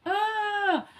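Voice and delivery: high sharp newborn wail, imitated; mother imitating her newborn's first cry, high. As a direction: mimic the baby's one sharp cry. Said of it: "nggak nangis oek-oek seperti baby".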